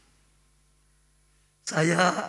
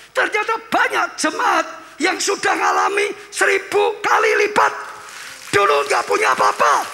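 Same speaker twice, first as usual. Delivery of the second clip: high, shouted sermon climax; preaching at full force; 9 semitones higher, 8 dB louder than usual. Acted. Pastor to congregation, shouting sermon climax.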